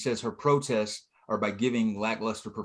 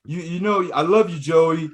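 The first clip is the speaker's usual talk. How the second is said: higher, louder earnest plea; mock boxer brother; 5 semitones higher, 6 dB louder than usual. Boxer to brother Joey, raised mock-sincere voice. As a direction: profess it like a sketch boxer.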